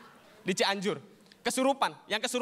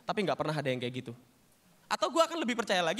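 usual speaking voice

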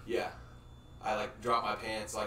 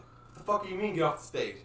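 indignant retort; 9 semitones higher, 6 dB louder than usual. Retold or imitated. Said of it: "Frankie's just like".

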